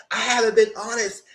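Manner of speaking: a hint of a whiny guy; mock Sincere. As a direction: imitate his pleading excuse. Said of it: "he said, in confessional".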